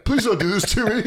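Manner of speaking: high, laughing plea for mercy; mock begging. Comic begging the chef not to kill him, mock terror. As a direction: beg for your life, half laughing.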